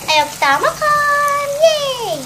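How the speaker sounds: high, slow, eager cheer; excited. Real, not acted. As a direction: squeal with delight at dinner. Eager to finally eat.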